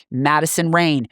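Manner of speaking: usual speaking voice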